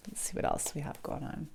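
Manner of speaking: whispering to herself while shuffling cards; breathy muttered aside, barely voiced; whispered aside. Real, not acted.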